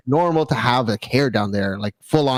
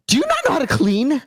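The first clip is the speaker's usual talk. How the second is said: nagging wife; 10 semitones higher than usual. Retold or imitated. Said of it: "She's like".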